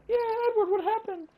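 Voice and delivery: mock-nonchalant falsetto impression; very high, airheaded girlish mimicry; Rebecca. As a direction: mimic a woman far too unbothered, in falsetto. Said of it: "And Rebecca's like".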